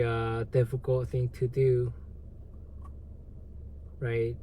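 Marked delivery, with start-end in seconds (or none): none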